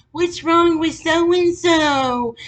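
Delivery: high, slow, loud frantic mother; mock frantic mom. Mom frantically texting her daughter, mock alarmed high voice.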